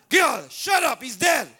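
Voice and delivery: put-on loud, high snap at the girl; scornful shout. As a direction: shut her up with a shout. Church dismissing the girl.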